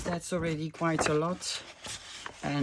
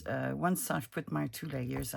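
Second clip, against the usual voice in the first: ordinary talk, nothing marked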